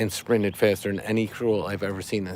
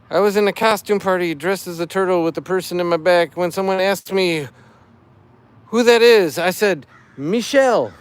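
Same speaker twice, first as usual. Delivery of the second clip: higher, louder corny fish puns; dad joke voice; 6 semitones higher, 4 dB louder than usual. Acted. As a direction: ham up the pun like a dad. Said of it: "I'm doing it in dad joke voice".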